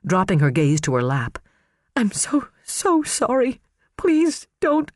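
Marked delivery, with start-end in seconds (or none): 2.0-4.9 high, hushed, broken apology; begging through tears; breathy, tearful. Acted. Karen confessing to her husband, hushed and tearful.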